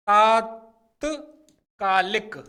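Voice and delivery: तात्कालिक drawn out slowly with a long first आ; slow careful pronunciation. Shown as a demonstration. Teacher models तात्कालिक to the class, slowly. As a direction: stretch तात्कालिक slowly, ता-त्का-लिक. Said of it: "तात्कालिक कहते हैं".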